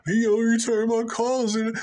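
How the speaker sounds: high, whiny mock female complaint; mocking complaining woman. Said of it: "complain about how men treating them".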